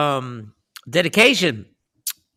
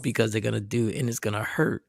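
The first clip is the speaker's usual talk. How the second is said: much softer, low gentle voice; soft hushed talk; 6 semitones lower, 10 dB quieter than usual. Retold or imitated. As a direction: talk him down softly. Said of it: "I talked like this".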